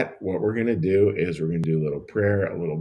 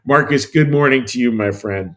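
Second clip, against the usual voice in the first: louder, lifted greeting; upbeat welcome to a viewer; cheery hello; 3 semitones higher, 7 dB louder than usual. Real, not acted. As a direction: call out a warm good morning. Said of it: "little cheery hello here".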